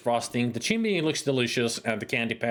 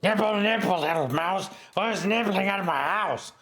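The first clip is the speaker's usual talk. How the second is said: witch voice; 7 semitones higher than usual. Acted. Streamer slips into a witch rhyme, higher-pitched and lightly voiced. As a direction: lightly croon it like the witch in the fairy tale. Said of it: "Oh, wait, that's not squeaky".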